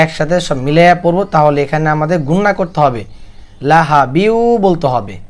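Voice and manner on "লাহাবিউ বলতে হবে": usual speaking voice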